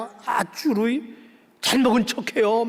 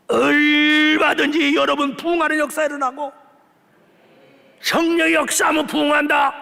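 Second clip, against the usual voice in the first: fiery preaching; 2 semitones higher than usual. Acted. Pastor rallies the congregation for revival, fiery shouting. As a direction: drive it home to the congregation.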